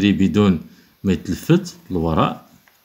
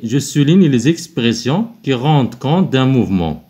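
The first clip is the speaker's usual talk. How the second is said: higher, slow dictation-style reading; slow instruction read; 5 semitones higher, 3 dB louder, much slower than usual. Acted. Teacher reading the exercise instruction to pupils, slow and clear. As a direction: read the task aloud slowly for the class.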